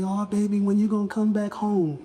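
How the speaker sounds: high, sweet church-mother coaxing; older church ladies. Retold or imitated. Older saints teasing him home, in a sweet coaxing voice. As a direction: coo it like an old church mother. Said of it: "they would say".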